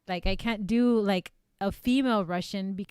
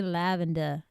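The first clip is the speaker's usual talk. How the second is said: "lavender" with an Australian open "-duh" ending; Australian-style "lavender"; Australian accent; 3 semitones lower than usual. Shown as a demonstration. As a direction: try "lavender" in Australian, no final r. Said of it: "if you wanna do Australian".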